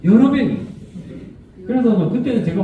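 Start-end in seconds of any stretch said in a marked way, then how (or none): 0.1-0.6 exasperated. Real, not acted. Exasperated telling, high.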